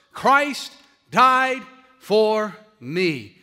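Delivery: very slow and loud, long pauses between words; emphatic proclamation. Real, not acted. Preacher telling the congregation what will matter on their deathbed, emphatic and slow. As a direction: drive home each word on its own.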